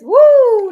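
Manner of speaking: loud, high "woo"; sudden high-pitched cheer; excited whoop. Real, not acted. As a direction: let out a big delighted whoop. Delighted reaction to the cards.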